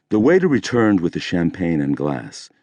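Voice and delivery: usual speaking voice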